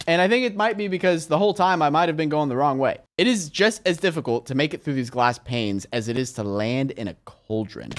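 ordinary talk, nothing marked

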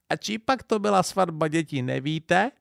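a high, lightly voiced old lady; sleepy old woman's kindly question; old grandmother. A narrator's reading. Old woman asking the couple about the wedding, high and sweet. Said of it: "babička".

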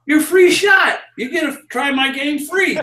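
high, called-out sales hook, easing in volume; retold barker's pitch. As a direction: sell the freebie to a passer-by, pitched high. Barker pitches his free game.

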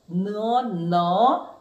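ordinary talk, nothing marked

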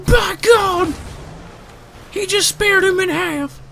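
high, excited wrestling announcer call; commentator impression. Gamer shouting a wrestling commentator's call. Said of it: "that's not the right thing that he says".

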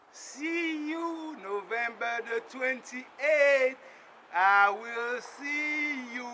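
very slow, drawn-out auction announcement. Acted. Announcing Haiti's auction date to the world, slow and drawn out. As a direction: stretch every word like a mock public notice.

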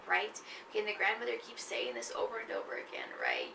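usual speaking voice

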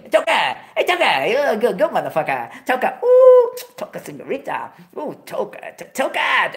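greeting workers in the casino, showing off; playful greeting. Retold or imitated. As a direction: hype them up like a big shot. Greeting in casinos, mock accent.

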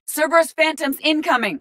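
high-pitched combat call-out; urgent enemy warning. Acted. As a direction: warn the squad of incoming enemies. Calling out enemies in combat.

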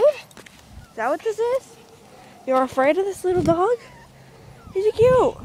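high, loud teasing taunt; mocking. Real, not acted. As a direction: tease them for being scared. Mocking someone afraid of dog.

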